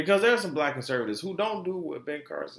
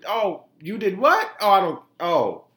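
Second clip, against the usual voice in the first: mock shock; 7 semitones higher, 6 dB louder than usual. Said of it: "I'm gonna look at".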